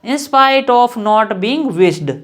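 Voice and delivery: very slow, word by word, spacing each phrase; slow dictation. Shown as a demonstration. Teacher dictating an example sentence to students, very slow. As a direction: spell it out slowly for students to copy. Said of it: "dictating the phrase slowly".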